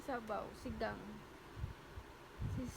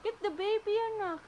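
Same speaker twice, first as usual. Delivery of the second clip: hammed-up fright to get the child to help the doll; mock alarm; 8 semitones higher, 8 dB louder than usual. (kunwaring gulat na malakas)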